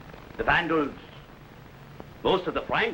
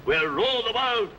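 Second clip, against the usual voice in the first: rising menacing boast; 9 semitones higher than usual. Acted. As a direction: swell into a conqueror's triumphant cry. Attila boasts of conquering the world.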